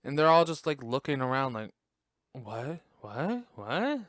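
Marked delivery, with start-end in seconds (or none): 2.3-4.0 baffled "What?" climbing in steps; puzzled questioning. Retold or imitated. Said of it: "they're all questioning each other".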